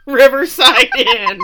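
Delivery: loud, high, stretched out through laughter; breaking into laughter. Real, not acted. Reading Gina's voicemail to co-host, breaking up laughing. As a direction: crack up while getting the words out.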